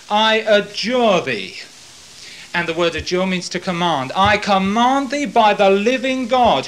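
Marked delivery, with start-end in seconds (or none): 0.1-1.6 slow, loud solemn order; solemn command. Acted. High priest commanding Jesus under oath, slow and loud. 4.1-6.7 slow, loud, higher solemn order; solemn command. Acted. High priest putting Jesus under oath, loud and slow.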